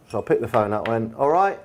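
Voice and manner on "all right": breezy, high "All right"; casual cheeky greeting. Retold or imitated. Mock-casual hello to a mate on the phone. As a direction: answer like it's a mate winding you up. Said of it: "like that, expected to be one of my mates".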